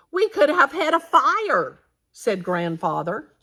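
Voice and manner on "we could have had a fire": alarmed. A narrator's reading. Grandfather realizing danger.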